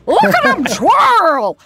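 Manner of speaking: high, loud, slow put-on lady voice; Pasadena woman impression. Actress imitates the woman she heard in Pasadena, high sing-song lady voice. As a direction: imitate the city hall woman she overheard. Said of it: "a woman who sounded like this".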